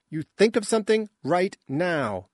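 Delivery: angry command. Acted. Jarek orders the ship to act, angry command. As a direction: demand it now, no excuses.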